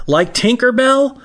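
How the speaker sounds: imitated child, very high, slow rising question; hopeful four-year-old, drawn out and climbing; little daughter. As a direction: ask it high and slow like a small girl. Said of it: "And Ava says".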